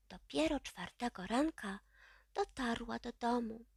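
ordinary delivery

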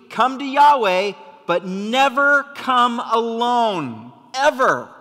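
loud, high, slowed on never; preacher pounding the point home; preaching yell. Real, not acted. Pastor to congregation, shouting the key point. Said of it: "I'm yelling because this is really important".

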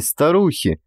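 usual speaking voice

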